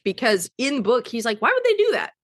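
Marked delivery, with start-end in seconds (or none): 1.4-2.1 much higher-pitched indignant question; high, disbelieving retold protest; incredulous. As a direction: imitate someone baffled on a friend's behalf. Voicing Shane's protest at the question.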